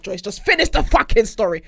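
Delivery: hammed-up impatient demand; impatient listeners. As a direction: imitate fed-up listeners, loud. Voices impatient listeners yelling.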